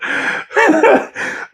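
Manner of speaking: real high, breathless laugh; airy laugh. Real, not acted. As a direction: laugh under your breath at the joke. Laughs after clip again.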